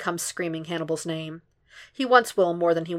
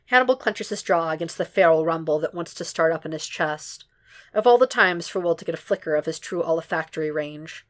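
ordinary delivery, nothing marked

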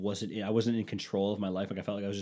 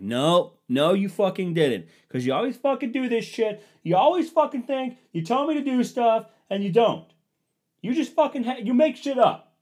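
much higher and louder, reliving the fight; retold yelling; 13 semitones higher, 9 dB louder than usual. Husband screaming at wife that she makes things up, retold shouting. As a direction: rant at her full tilt.

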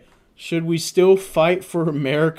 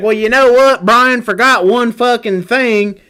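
put-on Kentucky accent; 5 semitones higher, 6 dB louder than usual. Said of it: "that thick-ass accent".

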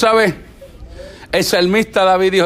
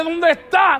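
mock shout; 6 semitones higher, slower than usual. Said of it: "él vuelve y dice".

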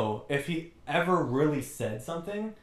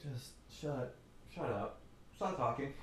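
much softer, fed-up mutter; annoyed mutter; 10 dB quieter, slower than usual. Retold or imitated. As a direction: grumble it under your breath. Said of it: "you're like".

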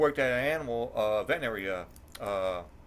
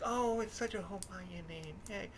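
mock cheery mother-in-law; 8 semitones higher, 8 dB quieter than usual. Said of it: "she was like".

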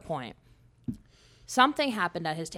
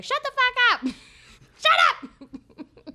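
much higher and louder, yelled through laughter; laughing shout; 9 dB louder, much slower than usual. Retold or imitated. Telling off a friend, laughing shout. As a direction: scream it at her while cracking up. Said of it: "I'd look her in the face and be like".

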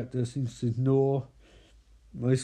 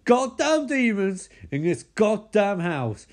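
higher, louder burst of exasperation; fed-up rant; 6 semitones higher, 5 dB louder, slower than usual. Acted. Micah rails at the demons in the house, exasperated. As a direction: build into an angry outburst on the curse.

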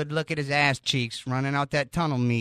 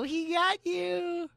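teasing; 4 dB quieter, much slower than usual. Real, not acted. Teasing after the burn.

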